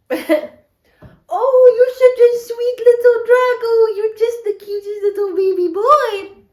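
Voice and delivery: high, loud cooing at a pet; sugary baby-talk coo to her dog. Acted. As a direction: gush over the little dog like a baby.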